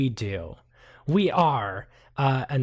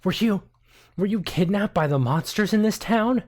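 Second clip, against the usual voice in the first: higher, dramatic shocked voice; gasping surprise; 7 semitones higher, 4 dB louder than usual. Acted. Journalist gasping about monster kidnapping, higher voice. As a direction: gasp then ask, pitched up. Gasp, high dramatic NPC.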